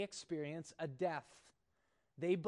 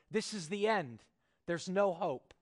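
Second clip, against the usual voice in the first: mock panic; 3 semitones higher, 7 dB louder than usual. Panic voiced inside read quote.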